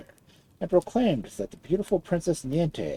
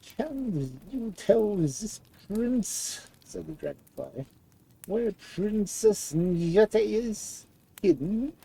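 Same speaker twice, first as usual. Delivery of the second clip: a small frail creature speaking carefully; dragonfly voice; 8 semitones higher, much slower than usual. A narrator's reading. Dragonfly warns the prince of danger, high and slow.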